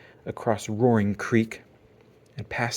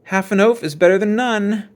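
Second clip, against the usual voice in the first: bully's jeer; 9 semitones higher, 8 dB louder than usual. A narrator's reading. Bully jokes at Brother.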